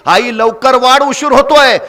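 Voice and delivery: ordinary delivery, nothing marked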